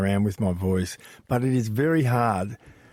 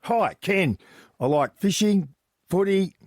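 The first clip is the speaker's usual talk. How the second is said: broad Australian bloke, pitched higher; super Aussie; 7 semitones higher than usual. Shown as a demonstration. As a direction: give it the full Aussie bloke accent. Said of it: "go super Aussie".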